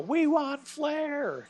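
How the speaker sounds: high, slow fan chant yelled out; stretched, high-pitched crowd holler; drawn-out yell. Retold or imitated. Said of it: "I stand up and I yell".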